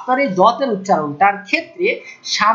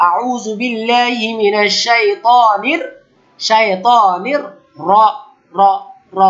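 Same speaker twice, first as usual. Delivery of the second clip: slow, chanted recitation; quran recitation; much slower than usual. Shown as a demonstration. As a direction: recite it slowly like tajweed practice. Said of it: "recitation demo".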